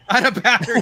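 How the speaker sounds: real laugh into high, loud words. Real, not acted. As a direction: crack up while repeating the excuse. Host cracks up repeating co-host's excuse.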